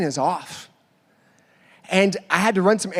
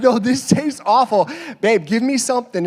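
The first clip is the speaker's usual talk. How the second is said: mock whining protest; 5 semitones higher, 4 dB louder than usual. Retold complaint to wife.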